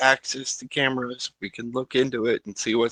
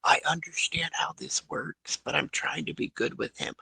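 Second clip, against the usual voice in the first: quiet unvoiced whisper to the guard; whispered aside; 3 semitones higher, 7 dB quieter than usual. Player whispers to the guard out of the doctor's hearing, whispering. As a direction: confide it under your breath.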